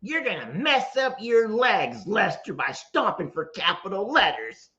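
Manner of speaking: hammed-up reading, starting high; all-caps voice. Streamer acting out a comment about stomping, mock emphatic. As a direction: play up every capital with big swoops. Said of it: "in all caps, I'm gonna start doing that".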